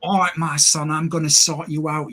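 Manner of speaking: a deliberately bad Cockney impression of Johnny Cage. Reviewer mocking Karl Urban's Cockney Cage, in a hammy Cockney accent. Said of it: "that was a terrible, um, impersonation".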